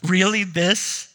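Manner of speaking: doubtful. Retold or imitated. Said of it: "you may be going".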